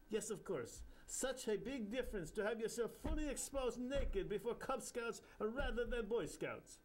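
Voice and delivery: drip with sarcasm like a guru; sarcastic Indian guru. Acted. Bapucharya mocking the narrator's correction, Indian accent.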